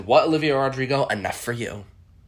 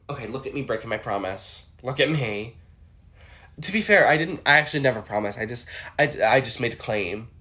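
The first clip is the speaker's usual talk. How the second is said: ordinary talk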